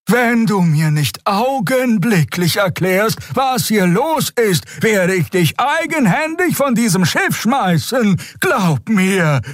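hohe, langsame, drohende Stimme der alten Xea; strenge alte Draxül. A narrator's reading. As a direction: alte Frau, die sich einem Riesen entgegenstellt. Xea droht Kero. (alte Xea warnt Kero drohend, hoch und langsam)

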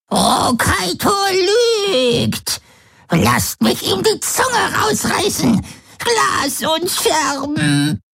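high, slow, sobbing croak; crazed croaking old woman. A narrator's reading. Mad old Voi raves about ripping out a tongue, slow high sobbing croak. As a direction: wail it out like a broken mad crone. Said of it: "krächzte Voi".

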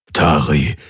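low-pitched address; dark voice. A narrator's reading. Hawks to guard Tari, low dark voice. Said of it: "Hawks dunkle Stimme".

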